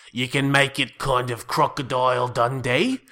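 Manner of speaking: loud, gruff Crocodile Dundee Australian; broad cartoon Aussie, drawn out and loud; over-the-top Australian accent. Shown as a demonstration. Improviser showing how outsiders think Australians talk, exaggerated Australian accent. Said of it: "You can get really gruff".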